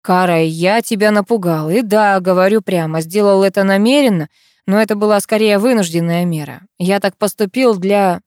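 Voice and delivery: ordinary delivery, nothing marked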